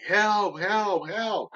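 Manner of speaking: retold cry for help, pitched high; yelling for help. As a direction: shout for help across the water. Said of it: "So I started yelling".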